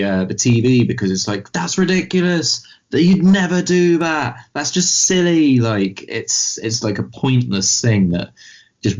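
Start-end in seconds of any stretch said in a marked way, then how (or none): 1.5-5.6 high-pitched protest at the TV; exasperated heckle, pitched way up; mock shouting. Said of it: "makes you shout at the".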